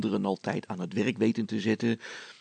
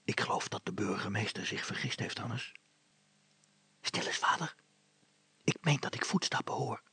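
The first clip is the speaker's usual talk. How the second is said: breathy hiding whisper; whispered, much softer; 5 dB quieter than usual. Acted. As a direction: whisper so the intruders won't hear. Father and son hiding at night.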